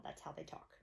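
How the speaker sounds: a soft whisper at bedtime. Real, not acted. Bedtime chat with a toddler.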